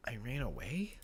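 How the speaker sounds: hushed, whispered question; soft whispering story character; Rory. Acted. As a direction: whisper it in doubt. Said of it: "I'm gonna do Rory's voice".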